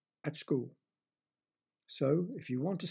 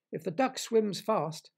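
frog voice; 5 semitones higher, 4 dB louder, slower than usual. Acted. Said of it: "said the frog".

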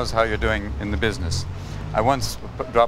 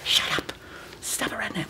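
hushed scold; 8 dB quieter than usual. Retold or imitated.

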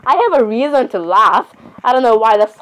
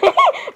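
short squeaky giggle; high-pitched giggle. Acted. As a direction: let a tiny high giggle slip out. Character giggles mid-argument.

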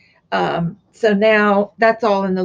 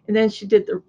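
hiccups; faster than usual. Real, not acted. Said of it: "I got the hiccups".

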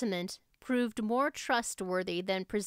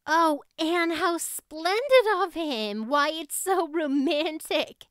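high, loud, slow gush of admiration; thrilled girlish gushing, high and loud; breathless delight; 7 semitones higher, 6 dB louder, slower than usual. A narrator's reading. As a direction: swoon over how romantic it is. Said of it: "finding breath enough for utterance at last".

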